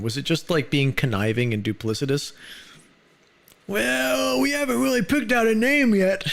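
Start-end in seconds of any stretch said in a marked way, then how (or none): 3.7-6.2 high, smarmy salesman voice. Retold or imitated. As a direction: play the slick salesman. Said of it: "the salesman".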